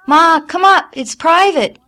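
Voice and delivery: high, loud urgent call. A narrator's reading. Daughter begging mother upstairs, urgent shout. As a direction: plead loudly for her to come.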